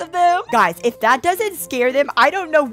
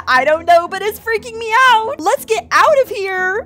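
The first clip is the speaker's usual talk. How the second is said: higher, louder, scared put-on voice; mock scared friend; 7 semitones higher, 5 dB louder, slower than usual. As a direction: act out the friend's panic. Voicing Riley's scared reply.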